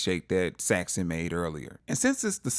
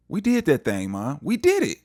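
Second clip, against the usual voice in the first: high, louder retold celebration; acted triumph; 6 semitones higher, 3 dB louder than usual. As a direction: celebrate to mom, climbing high on the last phrase. Acted son celebrating with his mother.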